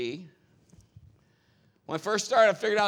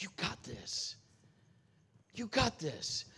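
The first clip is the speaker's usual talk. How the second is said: gentle encouragement; 9 dB quieter, slower than usual. Retold or imitated. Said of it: "he's like".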